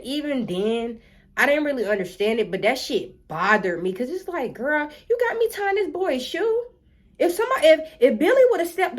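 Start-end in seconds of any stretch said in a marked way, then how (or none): 4.5-9.0 higher, incredulous jab; indignant disbelief. Retold or imitated. Kid retelling, indignant at the teacher. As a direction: scoff at the teacher's demand.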